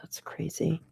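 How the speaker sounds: breathy whispered disbelief; whispering. Real, not acted. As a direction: whisper it under your breath. Muttered reaction while watching stream.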